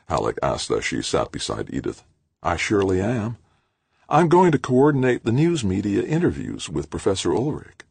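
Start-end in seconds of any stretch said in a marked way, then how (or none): 2.4-7.8 perky woman, higher and chatty; lightly voiced eager publicist; Edith's voice.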